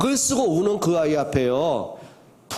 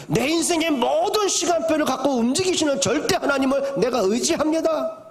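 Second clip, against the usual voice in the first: fervent prayer; 8 semitones higher than usual. Acted. Preacher voicing David's confession.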